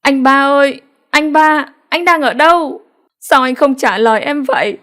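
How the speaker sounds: high, anguished calling out over the water; screaming out. A narrator's reading. Wife calling again for her missing husband, high anguished scream. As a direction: keep screaming his name, pleading for an answer. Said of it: "rồi lại tiếp tục gào lên".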